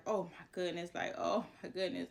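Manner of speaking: low, soft muttered dismay; hushed dismay. Retold or imitated. Painter overhearing the screaming boy, hushed dismay. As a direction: say it under your breath, low and helpless.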